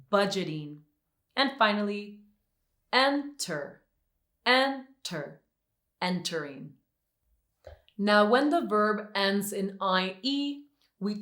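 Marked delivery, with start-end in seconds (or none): 2.9-5.4 "enter" with the first syllable stressed high, then falling. Shown as a demonstration. Teacher giving a final example, "enter" stressed on its first syllable. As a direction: start "enter" high and stressed, drop on the end. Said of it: "if the last syllable is not stressed".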